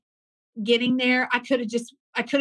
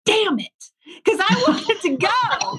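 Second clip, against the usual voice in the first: high, exasperated groan of a reaction; frustrated; 10 semitones higher, 4 dB louder, slower than usual. Retold or imitated. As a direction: say it like a frustrated curse. Frustrated inner reaction retold.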